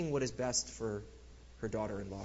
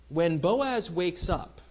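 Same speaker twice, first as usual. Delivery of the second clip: ordinary talk, nothing marked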